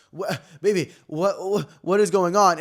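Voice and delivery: high, sputtering bafflement; hammed-up incredulous stammer; stammering disbelief. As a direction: sputter in mock outrage, ending loud. Mock incredulous address to absent neighbor.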